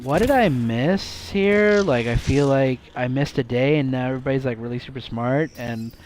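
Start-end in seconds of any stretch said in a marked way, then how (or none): 0.0-1.8 high, loud and slow; baffled disbelief. Real, not acted. Baffled outburst.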